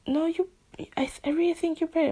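fast, high-pitched insistence from the friend; friend's high voice. Retold or imitated. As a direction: rush it high like an eager friend. Friend's reply in retold chat.

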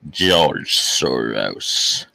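deep, drawn-out sinister name drop; slow theatrical villain menace. Pretend. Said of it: "dropping the name of".

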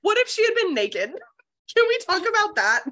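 high, loud indignant disbelief; mock outrage. Reader calling out the man who walked through walls, mock outrage. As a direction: squawk it in comic affronted shock. Outraged reaction to wall-walking intrusion.

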